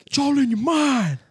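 very high pumped-up coach; squeaky hype-man imitation; motivational coach voice. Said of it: "You're like".

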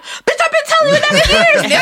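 high, loud mock outburst of frustration; hammed-up squawking comeback; exasperated retort. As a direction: imitate a fed-up partner, voice shooting up. Acts out baby daddy's exasperated reply.

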